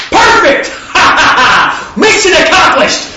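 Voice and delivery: high, loud, laughing triumph; God bursting with joy at the result; delighted God. Acted. Yahweh laughing loud at his son's success.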